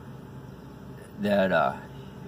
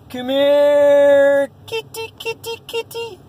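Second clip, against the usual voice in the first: high, drawn-out cat call; singsong neighbor calling his cat, very high; next-door neighbor impression; 13 semitones higher, 6 dB louder, much slower than usual. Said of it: "I'm gonna do an impersonation".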